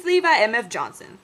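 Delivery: ordinary talk, nothing marked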